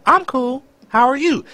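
high, breezy mock cheerfulness; fake cheerful. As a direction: lie brightly that all is fine. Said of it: "That ever crossed your mind to lie".

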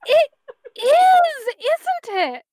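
high, loud delighted gush; bright, cheerful. A narrator's reading. Megan delighted by the eldritch horror, bright high gushing. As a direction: squeal it with glee, stretching the middle word. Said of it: "And Megan brightens".

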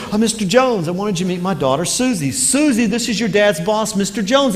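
nervously smooth things over; flustered mom. Retold or imitated.

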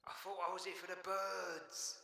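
very high, hushed little voice; small sheepish voice. Acted. Jack caught without warning, small sheepish voice. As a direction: squeak it softly as an aside.

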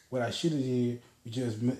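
ordinary delivery

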